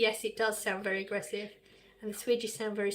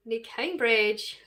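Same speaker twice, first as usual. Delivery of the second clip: ordinary talk, nothing marked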